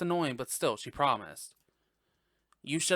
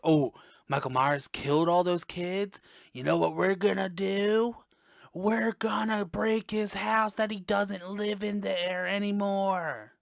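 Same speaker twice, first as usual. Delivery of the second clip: higher, slower mock-stupid voice; mocking dumb townsfolk; 5 semitones higher than usual. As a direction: ham up the idiot villagers' plan.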